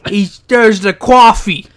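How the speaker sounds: slow, hammed-up New York drawl; mock New York accent, dragging it out. Quoting the wrestler's promo in mock New York accent. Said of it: "a New York accent".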